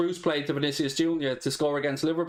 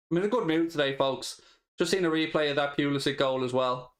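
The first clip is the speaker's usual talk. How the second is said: normal talking voice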